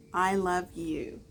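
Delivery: plain reading voice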